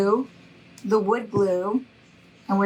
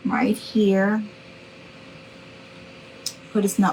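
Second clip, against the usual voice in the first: usual speaking voice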